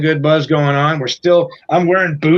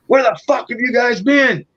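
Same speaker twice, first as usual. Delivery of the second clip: furious tour manager; 8 semitones higher than usual. Retold or imitated. Tour manager losing his shit.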